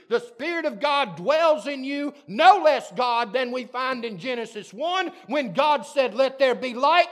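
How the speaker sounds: loud, high, slowing to shouted peaks; fiery preacher at the climax; preaching at full force. Acted. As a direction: thunder it out from the pulpit.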